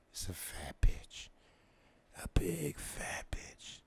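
slow, hushed disbelief; dismayed whisper. Retold or imitated. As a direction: breathe it out in horror.

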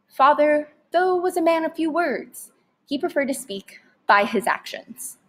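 usual speaking voice

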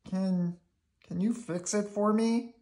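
high, hesitant plea; anxious puppet pleading; Bert. A narrator's reading. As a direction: ask haltingly in a high voice. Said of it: "Bert told him".

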